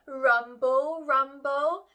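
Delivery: loud rumbling tummy word. A narrator's reading. Tummy noise voiced.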